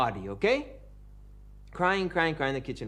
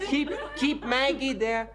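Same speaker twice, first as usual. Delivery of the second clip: laughing mock; 8 semitones higher, slower than usual. Mocking bedtime kissing.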